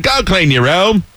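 hammed-up Aussie order; Australian accent. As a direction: stretch it out in broad Australian. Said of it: "That's Australian".

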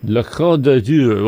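slow French-accented repeat; copying a French pronunciation, slowly; attempted French accent. Shown as a demonstration. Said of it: "people who have the French accent".

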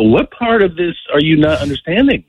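retold exasperated challenge. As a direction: press the question in disbelief. Retold exasperated argument with friend.

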